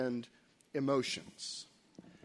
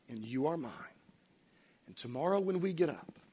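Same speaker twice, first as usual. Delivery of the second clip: tender fatherly reassurance; 4 semitones lower, 4 dB quieter than usual. Retold or imitated. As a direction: gently promise him you belong together. Dad comforting son at bedside.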